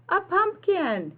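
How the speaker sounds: high, bright cry of delight; like a kid spotting treasure; a child's happy gasp of discovery; excited discovery. Acted. Tim finds first pumpkin.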